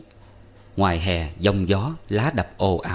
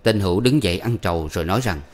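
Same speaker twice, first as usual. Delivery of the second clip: usual speaking voice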